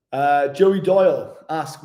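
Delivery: ordinary talk, nothing marked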